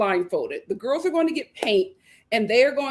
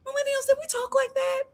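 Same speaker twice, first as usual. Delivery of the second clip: high question; 13 semitones higher, 4 dB quieter than usual. Real, not acted. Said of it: "asking her generation".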